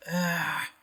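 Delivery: high, half-hearted no; unconvincing refusal. Retold or imitated. A member waves off the karate, high and half-hearted. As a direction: brush it off without meaning it. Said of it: "It's not very convincing".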